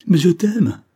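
loud, high outburst of love; desperate cry. Acted. Accused wife crying her love to husband, desperate. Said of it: "cria Claire".

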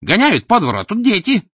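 high, loud outraged bystander; indignant onlooker. Acted. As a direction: complain to the crowd. (возмущённая зевака)

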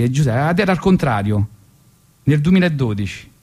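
ordinary talk, nothing marked

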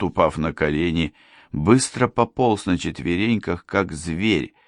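ordinary delivery

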